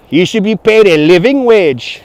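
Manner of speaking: slow, insistent mock French accent; French tourist accent. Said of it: "I had a guest from France".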